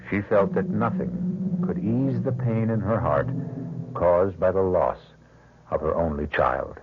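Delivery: measured, ominous host introduction; old-time radio narrator setting the scene; radio mystery host. A narrator's reading. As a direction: draw the listener into the dark tale.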